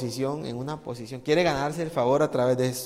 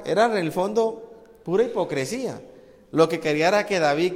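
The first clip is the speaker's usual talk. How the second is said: ordinary delivery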